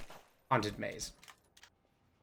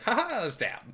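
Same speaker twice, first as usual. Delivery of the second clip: high, loud gleeful cackle-line; hammed-up villain glee; gleeful stabber; 11 semitones higher, 6 dB louder, slower than usual. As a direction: gloat as you stab, up high. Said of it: "I would be like".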